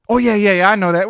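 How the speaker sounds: mock know-it-all protest; hasty retold bluff. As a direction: brush it off loudly as if you knew. Retold bluff of knowing all along.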